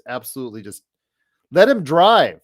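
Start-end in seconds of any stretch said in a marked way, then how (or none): 1.5-2.4 loud, high yell at the TV; yelling from the sidelines. Real, not acted. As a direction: shout the complaint at the screen. Yelling at player on TV.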